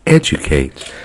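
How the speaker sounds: "Educate" pressed down low and firm; call-and-response "Educate" dropped low; emphatic repeat. Shown as a demonstration. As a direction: land "Educate" low, like a final answer. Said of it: "One more".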